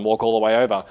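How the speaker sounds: ordinary talk, nothing marked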